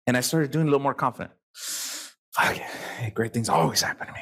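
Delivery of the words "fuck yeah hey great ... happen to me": hushed, breathy pep talk to himself; a secret mirror pep talk, whispered; whispered self pep talk. Retold or imitated.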